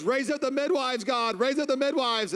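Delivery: high, loud mock prayer; hammed-up loud high intercession; fervent prayer. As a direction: cry it out like a revival preacher. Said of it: "and Jill goes".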